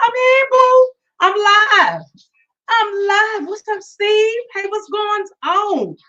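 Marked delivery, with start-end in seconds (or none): 0.0-4.7 excited. Real, not acted. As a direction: announce it with glee. Triumphant return to the show.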